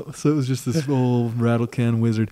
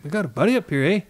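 higher, a lightly put-on Canadian accent with a tag "eh"; imitating a Canadian trucker, a bit higher, ending on "eh"; 5 semitones higher, 3 dB quieter than usual. Canadian trucker pitching a truck show, in a light Canadian accent. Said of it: "my Canadian blends with my Wisconsin".

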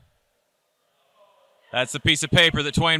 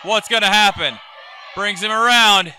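excited ringside play-by-play; wrestling commentator; 4 semitones higher than usual. Acted. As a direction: call the near fall live. Live play-by-play of the match.

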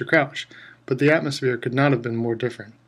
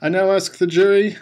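higher, louder command; shouting; 6 semitones higher, 7 dB louder than usual. A narrator's reading. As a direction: shout over the crying boy. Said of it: "shouted Mister Crouch".

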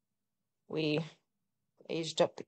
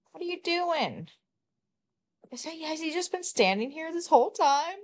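high startled questions, much higher; surprised; 11 semitones higher than usual. Real, not acted. Gamer startled to find a sim standing there, high surprised voice. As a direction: caught off guard, voice jumps up high.

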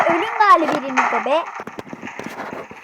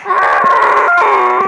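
startled cry; 3 semitones higher, 7 dB louder than usual. Real, not acted. Sudden attack surprise.

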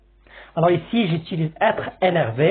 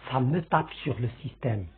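"Ça me tape sur le système" lower and much softer, heavy; graver tone; 4 semitones lower, 8 dB quieter than usual. Shown as a demonstration. Teacher showing the more serious way to say it, grave. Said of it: "on le dit d'une façon, euh, plus grave".

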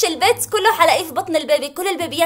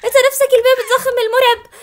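laughing in horror; 8 semitones higher, 7 dB louder, faster than usual. Real, not acted.

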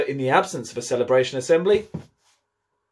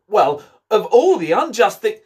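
louder, higher indignant protest; indignant outburst; 9 semitones higher, 8 dB louder than usual. Acted. Seven protesting the unfairness before spotting Alice, indignant. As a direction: start a furious complaint, then cut it off. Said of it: "had just begun".

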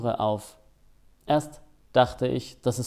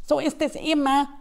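high hare voice, resigned sigh; 13 semitones higher than usual. Acted. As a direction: let the hare sound weary but high. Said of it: "sagte er resigniert". (resignierte, hohe Hasenstimme)

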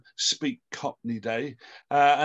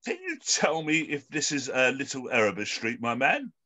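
normal talking voice

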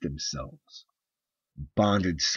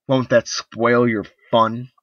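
louder, higher, slow biting sneer; bitter sarcasm; 5 semitones higher, 10 dB louder than usual. Acted. Wife mocking her husband's offer, slow sarcastic jab. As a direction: drag out the sarcasm.

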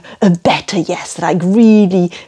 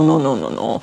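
"no, no, no, no" dropping low and soft; fading no; 6 semitones lower, 6 dB quieter, faster than usual. Shown as a demonstration. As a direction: let the no's sink away. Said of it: "or no".

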